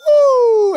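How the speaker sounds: very high, loud "woo"; piercingly high cheer; celebratory whoop. Real, not acted. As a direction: whoop for the made shot. Celebrates the three-pointer.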